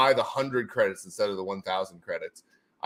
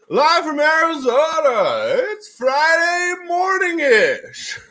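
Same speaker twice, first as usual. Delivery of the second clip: hyped announcer shout; 8 dB louder, much slower than usual. Acted. Streamer hyping going live.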